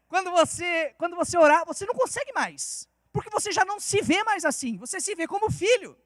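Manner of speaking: normal talking voice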